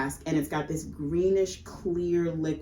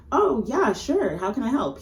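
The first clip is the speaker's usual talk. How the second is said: mock friendly; 7 semitones higher, 4 dB louder than usual. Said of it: "He's not like".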